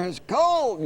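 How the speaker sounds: high puppet complaint. Acted. Dummy Orson telling the ventriloquist, high puppet voice. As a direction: shiver it out in the dummy voice.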